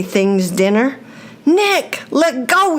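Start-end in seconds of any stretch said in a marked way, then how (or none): 1.5-2.8 high, sharp shout; urgent shout. A narrator's reading. Brigham shouting at Nick to drop the pole, urgent shout. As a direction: yell at your friend to let go. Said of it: "he shouted".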